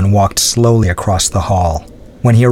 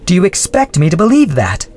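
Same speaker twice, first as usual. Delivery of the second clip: mild scorn, higher voice; scornful; 9 semitones higher than usual. Acted. Adrienne scoffing at the Baron, mildly scornful. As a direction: scoff lightly at him. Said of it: "asked Adrienne scornfully".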